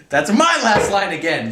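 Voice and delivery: high, loud, slow outraged shriek; colonel's shriek. A narrator's reading. Colonel enraged at corporal, shrieking. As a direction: shriek it with mounting rage. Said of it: "shrieked the colonel".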